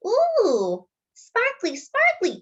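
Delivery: put-on high, cooing toddler voice; little brother marveling, pitched very high; delighted little boy. Toddler brother admires sister's skirt, high and cooing.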